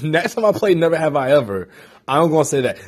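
usual speaking voice

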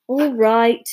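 ordinary delivery

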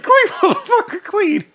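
very high, loud mock yell with laughter; retold shouting. Retelling dad's broomstick rampage about the room, high laughing shout. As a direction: imitate dad yelling, cracking up. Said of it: "started shouting some shit".

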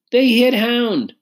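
put-on high, slow child's complaint; high-pitched, aggrieved child; upset little girl. A narrator's reading. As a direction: tell on them to Daddy, drawn out. Child tells daddy of dog's hurt.

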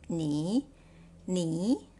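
你 with a rising tone; second tone. Shown as a demonstration. Teacher showing the tone change to learners, 你 rising. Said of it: "is read as the second tone".

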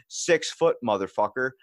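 his words quoted plainly, a small sneer; mocking his insult. Quoting the stranger's insult at Food Lion, mild sneer. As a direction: toss off the stranger's insult flatly. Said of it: "he just turns around and goes".

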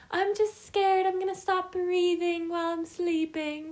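high, whiny imitation of friend; mocking friend. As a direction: mimic her worried whine. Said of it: "this girl has been saying".

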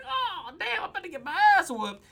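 high-pitched rush of comic dismay; hammed-up, high panicky realization; mock dread. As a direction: wail it fast, as someone who knows he's doomed. Acting Moses's dread of a beating.

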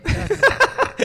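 sudden high burst of laughter; bright squeal of a laugh. Real, not acted. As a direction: laugh out at your own slip. Laughs at his own slip.

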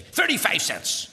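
an irritated waitress cutting him short; impatient waitress. Retold or imitated. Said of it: "she says very impatiently".

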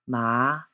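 "ma" sliding upward from low; second tone. Shown as a demonstration. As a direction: lift "ma" like a question. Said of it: "a rising note".

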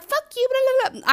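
high, loud mock cursing; mock hostile outburst. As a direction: exaggerate the rant you would never give. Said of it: "So I'm not like".

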